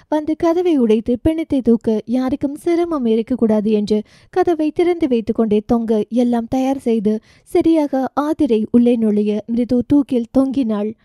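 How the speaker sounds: usual speaking voice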